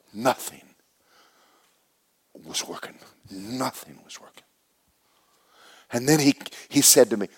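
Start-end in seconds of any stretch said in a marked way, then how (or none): 0.1-4.4 dropping to a near whisper; hushed despair. Retold or imitated. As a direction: let the words fade out in defeat. Despair retold, voice drops away.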